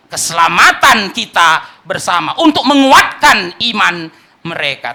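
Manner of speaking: loud and slow, each word pressed; forceful preaching. Real, not acted. Preacher urging the church to strengthen faith, loud and slow. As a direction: hammer it home to the congregation. Preacher drives home a point.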